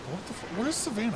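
high, baffled "where's Savannah"; squeaky mock confusion; confused girl.

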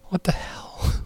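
breathy whisper to himself. Pretend. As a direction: whisper it under your breath. Aside after being hung up.

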